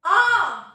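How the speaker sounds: आ very loud and sustained; आ from the belly. Shown as a demonstration. Teacher demonstrating to pupils, आ from the belly. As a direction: drive आ up from inside the stomach. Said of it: "पेट के अंदर से आवाज".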